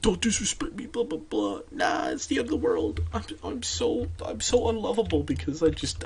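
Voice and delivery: high, hushed mock whining; self-pitying outburst, played softly for mockery; mock meltdown. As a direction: imitate someone melting down, in a small high whispery voice. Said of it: "blew up and you're like".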